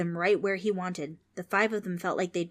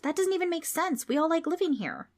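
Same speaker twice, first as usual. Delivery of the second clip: put-on indignant girl; 10 semitones higher than usual. Trix protests.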